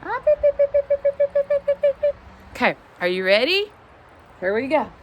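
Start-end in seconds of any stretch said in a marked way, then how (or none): 0.0-2.1 high, loud, rapid-fire beeps; chicken call. Real, not acted. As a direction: call the hens with shrill staccato beeps. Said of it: "That's how I say, "Come here, chickens".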